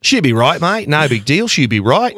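put-on breezy Aussie no-worries voice in an Australian accent; imitating a laid-back Australian accent. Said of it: "the Australians have really cool sayings".

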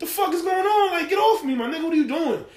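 high-pitched retold outrage; indignant protest. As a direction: act out a man objecting to being grabbed. Acted father protesting to police.

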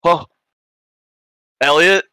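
high, loud, surprised cry of a name; surprised call. Acted. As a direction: call out in sudden recognition. Spots his friend, calls out.

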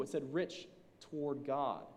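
slow down and set "toward God" apart after "rich"; stress on God. Real, not acted. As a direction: pause after "rich", land "God" low and deliberate. Correcting a misreading of the verse.

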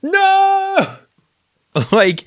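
high laughing "No!"; laughing protest. Real, not acted. Laughing reaction to joke.

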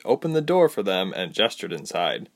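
ordinary delivery

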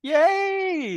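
high, bright cheer of joy; high-pitched yay for the engaged friend; excited cheer. Real, not acted. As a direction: cheer for the happy couple.

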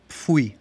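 "pfui" with pf and a gliding "ui"; German disgust word. Shown as a demonstration. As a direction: say "pfui" as one syllable, pf first. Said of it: "una expresión que tenemos para el disgusto". («pfui» alemán, con el diptongo «ui»)

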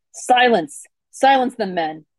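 loud barked command; shouting. A narrator's reading. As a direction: shout the order over the noise.

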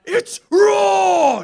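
high, drawn-out roar; Gordon Ramsay yell. Retold or imitated. Imitating the TV chef on Kitchen Nightmares, roaring. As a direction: shout it like a furious TV chef. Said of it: "Kitchen Nightmares with Gordon Ramsay".

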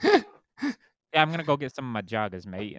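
mock British drifting through Irish, Scottish and Australian; muddled British accent. Shown as a demonstration. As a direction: do a British accent that wanders all over. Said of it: "a mix of Irish, Scottish, Australian".

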